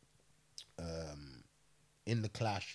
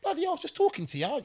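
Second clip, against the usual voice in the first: high, fast, offhand put-on voice; casual Chopper; 6 dB louder, faster than usual. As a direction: shrug off a stabbing like a minor annoyance. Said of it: "He's so casual".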